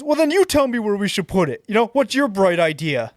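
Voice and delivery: retold shout at a stranger; mock yell. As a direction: throw it back at him like a pushy old man. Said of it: "he starts yelling at me".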